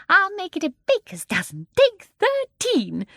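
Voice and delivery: high, loud, generous flourish; cheerful. A narrator's reading. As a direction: offer it cheerfully, drawing out the end. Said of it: "said Missus Corrie cheerfully".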